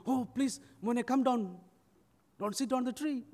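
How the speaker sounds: high-pitched imitated plea; pleading request. Neighbours asking the boy to come down, pleading. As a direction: lift the voice and coax. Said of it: "they were also asking".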